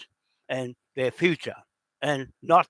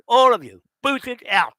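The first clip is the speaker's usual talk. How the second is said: raised, loud angry command; angry shout; 8 semitones higher, 7 dB louder than usual. Real, not acted. Ranting at those who support Israel, shouting. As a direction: yell at them to get out.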